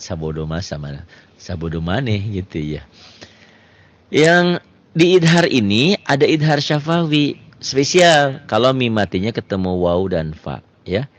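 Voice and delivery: ordinary talk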